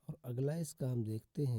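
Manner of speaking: ordinary delivery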